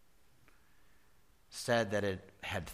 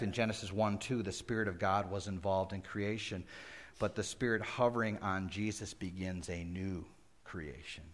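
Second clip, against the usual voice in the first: ordinary talk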